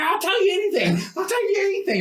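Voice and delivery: high-pitched frantic plea; mock panicked cry, way up high; desperate begging. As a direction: beg the torturers to stop. Acted victim begging under torture.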